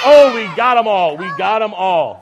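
cheering the class's shout; delighted shout. Real, not acted. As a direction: shout with joy as the names ring out. Children just yelled names.

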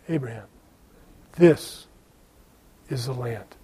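God speaking softly and low, each word set apart; hushed voice of God. Acted. Preacher voicing God quietly to Abram.

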